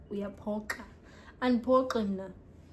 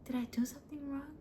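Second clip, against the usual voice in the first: whisper; 5 semitones higher, 6 dB quieter than usual. Pretend. Mock-hurt question.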